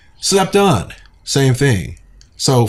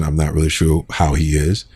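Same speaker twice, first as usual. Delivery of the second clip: usual speaking voice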